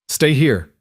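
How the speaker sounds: firm order. A narrator's reading. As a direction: order them firmly to stay put. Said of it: "he ordered".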